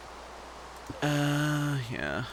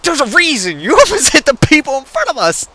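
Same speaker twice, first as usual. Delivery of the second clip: high, laughing retort; exasperated comeback; 12 semitones higher, 6 dB louder than usual. Retold or imitated. Passenger answering reckless sister, high laughing protest. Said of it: "I'm like".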